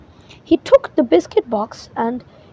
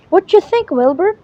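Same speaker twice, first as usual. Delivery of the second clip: bright question, higher and louder; eager question; 7 semitones higher, 4 dB louder than usual. Acted. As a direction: show off the new outfit, fishing for praise. Winnie shows off her outfit.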